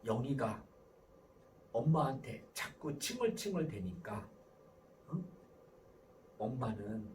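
low, soft, patient explaining; hushed, measured parental request; gentle mother. Shown as a demonstration. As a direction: calmly tell a child how you feel. Models gentle mother explaining her state.

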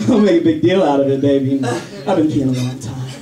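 low, dry embarrassed dad; deadpan dad. Retold or imitated. Dad answering daughter's praise, low and dry. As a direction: brush it off flatly.

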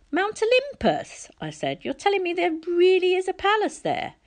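can't believe it; astonished boy. A narrator's reading. Percy astonished.

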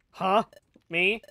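high, loud astonished yelp; surprised when asked to be partner; startled surprise. Acted. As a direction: caught completely off guard.

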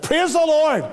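ordinary delivery

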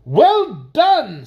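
loud, high, hearty well-done; Duke's hearty cheer. A narrator's reading. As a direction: cheer it out like a proud duke. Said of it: "stated the Duke of Definition".

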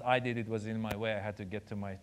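put-on quietly matter-of-fact Iranian accent; mom's Iranian accent. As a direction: play mom answering in an Iranian accent. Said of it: "has the accent, and she talks like this".

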